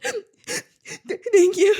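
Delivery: high, breaking, laughing thank you; choked-up thanks. Retold or imitated. Runner thanking a kind stranger, choked up and high. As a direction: gasp out thanks on the verge of tears. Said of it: "And I was like".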